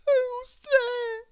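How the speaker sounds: very high, small voice; a little girl calling out, sad. A narrator's reading. Miss Giggles answers the door, sad little voice. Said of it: "asked a sad little voice".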